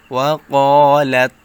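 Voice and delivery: ওয়া ক্বালাত with the ক্বা held long and loud; four-count stretch. Shown as a demonstration. Teacher to students, demonstrating the long stretch loudly. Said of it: "চার আলিফ টান দিতে হবে".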